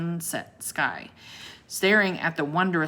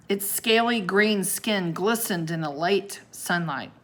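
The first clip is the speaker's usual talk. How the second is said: ordinary delivery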